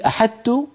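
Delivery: put-on "أحطت" said high, like a child, as a plain "أحت"; a child's "أحطت" with the ط dropped; childlike lisping demo. Shown as a demonstration. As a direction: mimic a child saying "أحطت" with no ط. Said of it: "مثل كلام الأطفال".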